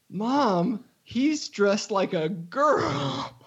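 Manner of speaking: high-pitched imitation of a small child tattling to Mom; giggly four-year-old. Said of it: "loudly giggles and goes".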